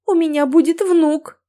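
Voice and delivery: high, mildly tearful exclamation; sobbing. A narrator's reading. Mother overcome at news of grandson, mildly sobbing. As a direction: let a touch of a sob in, pitched high. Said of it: "всхлипнула мама".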